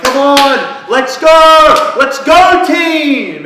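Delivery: full-force high, drawn-out cheer; pumped-up yell. Retold or imitated. Girl rubbing it in to her losing team, pumped-up yelling. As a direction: holler a hammed-up team rally, stretching the last words.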